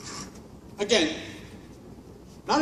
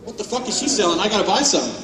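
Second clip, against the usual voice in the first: ordinary talk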